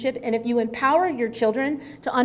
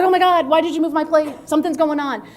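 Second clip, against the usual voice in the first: mock panic; 5 semitones higher, 6 dB louder than usual. Mimicking a panicked high-conflict parent.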